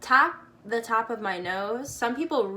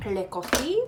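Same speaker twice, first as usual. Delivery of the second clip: mock French. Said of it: "when they are French".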